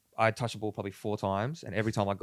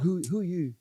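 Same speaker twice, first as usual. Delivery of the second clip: put-on Croatian accent; 7 semitones higher than usual. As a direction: fake Croatian accent, mocking. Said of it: "fake, fake, fake Croatian accent".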